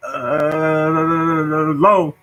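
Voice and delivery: very slow stammer with long blocks; heavy stutter. Shown as a demonstration. Young self pleading with bullies, heavy drawn-out stutter. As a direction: drag each sound out, straining towards the next word. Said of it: "I was like".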